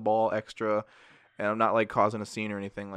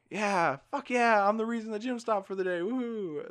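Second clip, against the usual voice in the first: mock cheer; 11 semitones higher than usual. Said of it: "as a joke".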